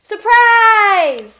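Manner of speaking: big high, loud jump-out yell; huge playful surprise shout. Acted. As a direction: shout it like leaping out of hiding. Horace pops out of pumpkin.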